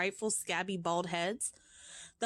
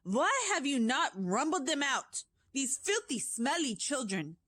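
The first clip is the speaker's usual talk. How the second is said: witch crying out; 6 semitones higher than usual. Acted. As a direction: rant with disgust at the children. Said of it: "she screamed".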